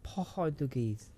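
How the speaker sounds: put-on Portuguese accent. Showing friend how Portuguese say their name, mock Portuguese accent. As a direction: stretch it out in a hammed-up Portuguese accent. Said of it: "That's how they say their name over there".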